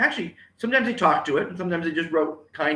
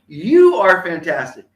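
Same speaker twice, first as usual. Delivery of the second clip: very loud, high, drawn-out YOU; powerful rally call; 4 semitones higher, 10 dB louder than usual. Shown as a demonstration. Speaker cheers on a named viewer, powerful rally call. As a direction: belt the praise out slowly. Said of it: "get the power of those words".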